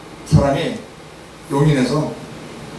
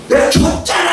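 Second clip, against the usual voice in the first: high, strained shout; acted outburst, pitched way up; drunk's protest; 11 semitones higher than usual. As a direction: yell it like an indignant drunk. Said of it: "술 취한 사람은요".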